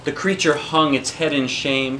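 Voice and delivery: plain reading voice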